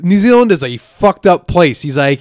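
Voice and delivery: normal talking voice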